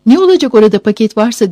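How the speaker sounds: shouted retort. A narrator's reading. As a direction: call back crossly to your son. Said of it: "diye bağırdı oğluna".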